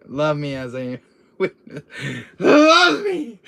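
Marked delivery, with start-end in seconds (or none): 2.4-3.4 high, loud drawn-out cry; dramatic plea. Acted. Quoting a demand for love, hammed-up loud plea. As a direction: wail it out, hammed up. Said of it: "I'm still gonna use you saying".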